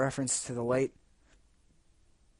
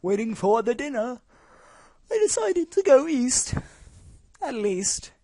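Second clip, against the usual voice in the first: high slow character voice; 11 semitones higher, 6 dB louder than usual. Acted. High slow far read.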